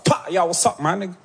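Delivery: rapid street American English; American accent, full of swagger; American cousin. Acted. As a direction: imitate an American guy confronting someone. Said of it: "il vient vers moi comme ça".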